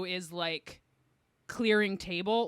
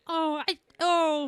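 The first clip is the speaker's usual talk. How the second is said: higher-pitched polite "oh"; feigned polite sympathy; 8 semitones higher, slower than usual. Acted. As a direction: act politely taken aback by a relative's story. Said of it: "you have to be like".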